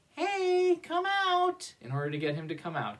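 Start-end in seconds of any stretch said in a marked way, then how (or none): 0.1-1.8 high, bright call to a shy friend; calling to the puppet mouse; coaxing call. Shown as a demonstration. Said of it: "so you're gonna wanna say".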